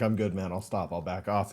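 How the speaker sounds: retold softly, pitched low; calm, in control. Marine backing off as the bouncer holds him, calm and low. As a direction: concede quietly, without heat. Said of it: "He didn't lose control".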